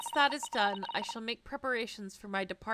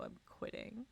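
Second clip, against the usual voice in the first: whispered under the breath; a hushed, mock-serious threat; 13 dB quieter than usual. Real, not acted. Player whispering to the game, mock-serious threat.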